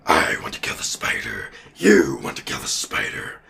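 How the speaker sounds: low, whispery monster rasp; symbiote voice. Acted. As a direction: growl it under the breath like an alien symbiote. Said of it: "the symbiote talks".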